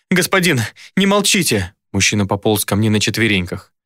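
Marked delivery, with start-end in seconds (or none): 0.1-1.7 higher, pleading cry; desperate pleading. A narrator's reading. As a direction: beg the silent master. Captive begs.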